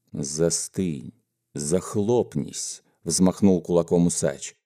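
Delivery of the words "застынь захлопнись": soft, dragged-out threatening command; low menace. Acted. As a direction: shut him up with quiet threat. Boss threatens with raised fist. (тихая низкая угроза)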